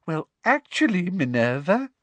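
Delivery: high, sugary, coy correction; simpering. A narrator's reading. Intruder correcting rival professor, simpering. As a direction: simper as you contradict her. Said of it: "simpered Professor Umbridge".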